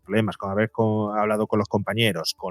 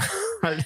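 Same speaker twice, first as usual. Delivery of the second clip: high laugh; 3 dB quieter than usual. Real, not acted. Speaker laughs at himself.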